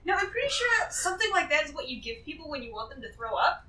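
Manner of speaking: ordinary talk, nothing marked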